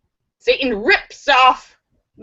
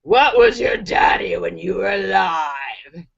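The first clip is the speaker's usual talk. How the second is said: Satan; 5 semitones lower than usual. Acted. Satan questions the first dead man, menacingly. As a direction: intone it deep like a demon. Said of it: "Satan asks the first guy".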